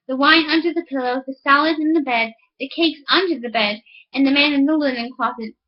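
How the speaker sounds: ordinary delivery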